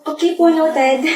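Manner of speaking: slow, high-pitched echo. Acted. Student echoes answer to order, slow and high.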